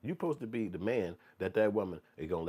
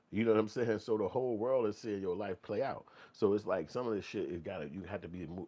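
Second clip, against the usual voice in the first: ordinary talk, nothing marked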